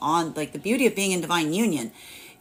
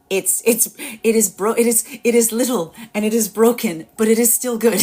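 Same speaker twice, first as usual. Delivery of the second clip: voicing the energy; 3 semitones higher, 4 dB louder than usual. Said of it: "this is the energy that says".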